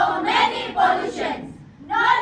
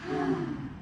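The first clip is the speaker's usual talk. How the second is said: contented sigh; 9 dB quieter than usual. Acted. Sigh of relief.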